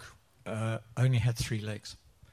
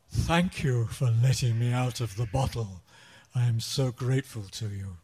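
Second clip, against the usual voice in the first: booming, menacing genie voice; frightening genie voice; 5 dB louder than usual. Acted. Genie thanking the man who freed him, menacing voice. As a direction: loom over him as the terrifying genie. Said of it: "spoke in the most frightening, terrifying voice".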